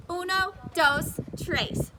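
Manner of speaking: slow, loud, high Spanish counting. A narrator's reading. May showing off her Spanish to classmates, loud. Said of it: "she said real loud".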